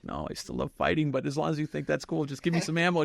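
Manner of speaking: put-on a kid itching for a fight; eager for ammo. Son asking his dad for arguments, gleeful.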